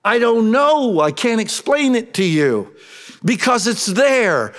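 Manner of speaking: high, loud mock pleading; exasperated child. Boy explaining himself to grown-ups, high and exasperated. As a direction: act a kid who cannot explain himself.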